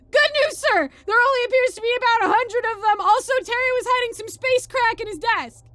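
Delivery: high, loud recruit's yell; shouted report. Acted. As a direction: report at full volume to the sergeant. Private shouts report to sergeant.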